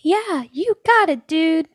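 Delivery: high, chirpy mock cool reply; casual reply. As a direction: toss it off high and breezy. Her own reply voiced.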